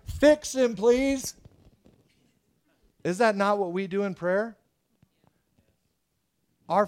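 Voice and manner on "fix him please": higher and louder urgent plea; begging friends. Retold or imitated. Four friends drop paralyzed buddy before Jesus, begging. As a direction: beg Jesus to fix him.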